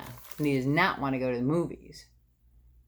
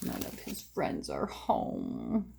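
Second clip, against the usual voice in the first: sad sympathy; 9 dB quieter, much slower than usual. Real, not acted. Sad friends away.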